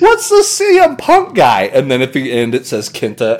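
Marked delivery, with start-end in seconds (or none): 0.0-1.6 high, slow, loud goofy meme voice. Retold or imitated. Telling friends about the brain meme, high goofy mock voice. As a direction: ham up a dumb meme voice. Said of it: "it's like".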